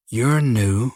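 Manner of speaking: unhurried Texan drawl with a lazy, friendly lilt; a killer's easy Texan drawl, just a hint of it; slight Texan drawl. A narrator's reading. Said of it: "a slight Texan drawl to his voice".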